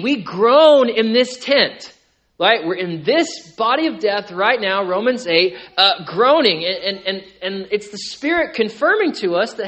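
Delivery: loud, impassioned preaching; sermon at full volume. Acted. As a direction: lean into the sermon, voice lifted. Preaching on groaning in this tent.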